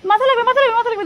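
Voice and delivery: high urgent warning; warning call. Real, not acted. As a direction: call out sharply so he ducks. Mother warning child near doorway.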